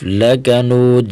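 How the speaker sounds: ordinary delivery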